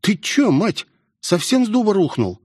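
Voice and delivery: put-on high-pitched girl scolding a friend; Melvin, incredulous. A narrator's reading. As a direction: chide him in a high, amazed voice.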